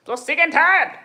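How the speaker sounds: loud, high exasperated outburst; fed up. Acted. Voicing a viewer fed up with their hair, exasperated shout. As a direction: cry it out in mock frustration. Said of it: "now you're like".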